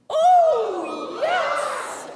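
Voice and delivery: high, loud joyful shout; cheering oh yes; excited oh yes. Shown as a demonstration. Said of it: "We would say".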